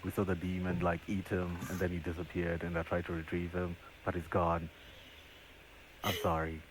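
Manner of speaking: low, soft, subdued confession; note read aloud. Acted. Player voices a note apologising for a failed rescue, low and soft. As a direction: break the bad news softly and low. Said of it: "the paper's gonna say".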